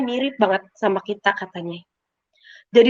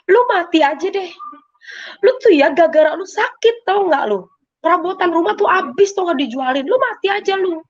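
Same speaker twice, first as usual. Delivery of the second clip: high-pitched, retold angry scolding; scolding sibling; 7 semitones higher than usual. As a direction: act out an exasperated sibling berating you. Kakak memarahi adik yang sakit. (omelan kakak yang kesal, bernada tinggi)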